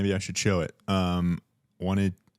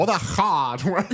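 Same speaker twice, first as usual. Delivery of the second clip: put-on slow old-time announcer, higher; mock old-time radio drawl; 8 semitones higher, 5 dB louder, slower than usual. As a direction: drag it out like an old TV host. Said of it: "That was better".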